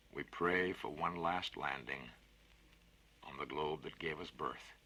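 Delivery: slow, solemn recited verse; poem recital. Acted. Narrator reciting the dying spaceman's song, slow and solemn.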